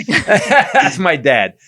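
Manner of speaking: laughing, dragging it out slowly; cracking up at the punchline. Real, not acted. Host finishes his dad's funeral joke, laughing.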